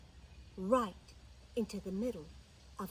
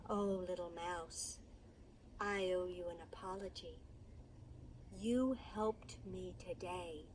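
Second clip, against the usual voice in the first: low, soft, sincere apology; quiet, grateful big lion; humbled lion; 4 semitones lower, 7 dB quieter than usual. Acted.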